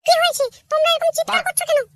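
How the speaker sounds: high-pitched scolding wife, mocking disbelief; a nagging wife who doesn't buy his promise; sarcastic wife. Wife mocks husband's promise to quit drink, high and scornful.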